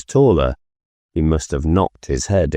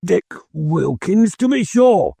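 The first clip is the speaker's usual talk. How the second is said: put-on much higher, eager old Scrooge; fond excitement; 13 semitones higher than usual. A narrator's reading. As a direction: delight at seeing an old friend. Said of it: "said Scrooge to the ghost".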